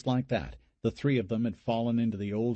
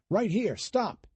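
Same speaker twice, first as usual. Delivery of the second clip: calling out; 12 semitones higher, slower than usual. A narrator's reading. Anthony halting the boat.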